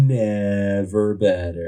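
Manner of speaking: never better drawn out slowly like a big reveal; milk the slogan, slow and grand; hammed-up slogan. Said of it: "say it with me, Steven".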